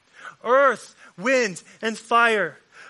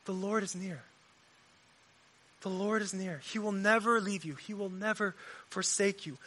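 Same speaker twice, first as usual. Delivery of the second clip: soft and hushed, far below his preaching voice; God's gentle whisper; 7 dB quieter than usual. Acted. Preacher voicing God's reassuring whisper to the hurting, soft. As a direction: speak it close, as if right at the ear. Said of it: "But God whispers".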